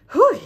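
high, loud puffed-out whew; breathless whew. Real, not acted. After counting to forty, a breathless whew. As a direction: blow it out after the long count. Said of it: "I am out of breath".

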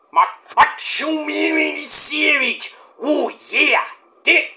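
high, very slow put-on wrestler voice; stretched-out hammed-up wrestler voice; Hulk Hogan impression. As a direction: ham it up like a wrestling promo. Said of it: "You know, Hulk Hogan".

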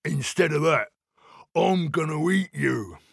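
menacing storybook troll; put-on troll voice, relishing the threat. A narrator's reading. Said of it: "said the Troll".

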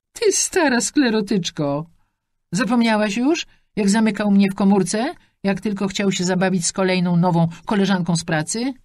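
hammed-up granny telling her off; old lady scolding. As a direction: Alicja scolding her forgetful friend in a high granny voice. Angry accusation in quarrel.

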